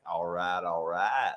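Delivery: low-pitched Texas drawl impression; McConaughey. Comedian mimicking a sexy Texas accent, low and easy. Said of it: "McConaughey, like".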